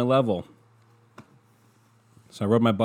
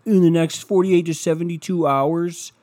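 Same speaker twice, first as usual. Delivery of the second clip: higher, louder put-on announcing voice; mocking conspiracy believers; 7 semitones higher, 6 dB louder than usual. Vlogger parodying QAnon predictions, mocking high voice. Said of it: "it's been, like, so funny".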